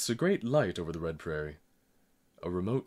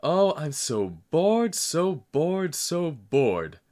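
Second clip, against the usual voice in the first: put-on high, slow, loud child's wail; 9 semitones higher, 10 dB louder, much slower than usual. A narrator's reading. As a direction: wail it out like a bored child crying. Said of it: "I burst into loud wails".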